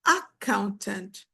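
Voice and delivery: "accountant" wrongly stressed AC-coun-tant; misplaced stress. Shown as a demonstration. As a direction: punch the first syllable of "accountant". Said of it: "You do not say".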